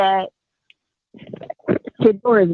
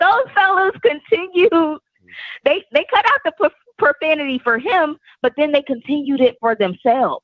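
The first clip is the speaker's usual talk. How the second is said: raised pitch, exasperated; indignant; 8 semitones higher than usual. Real, not acted. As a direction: lay out the hypocrisy, outraged. Indignant about the players.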